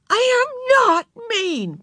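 very high, slow, emphatic yell; shouting back. A narrator's reading. Beezus shouting back at Ramona, high and angry. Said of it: "Beezus shouted back".

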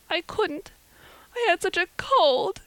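high, tearful wail. A narrator's reading. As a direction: wail the excuse through tears, pitched high. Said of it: "dissolved into tears".

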